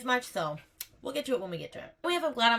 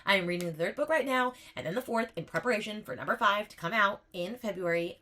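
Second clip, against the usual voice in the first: usual speaking voice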